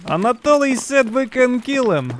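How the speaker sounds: high-pitched, drawn-out call. Real, not acted. Player tells teammates about a kill, calling out. Calls out over a fight.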